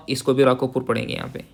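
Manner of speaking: ordinary delivery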